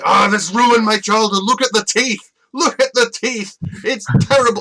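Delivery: high, loud mocking whine of a complaining fan; whiny YouTuber. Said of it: "where YouTubers say".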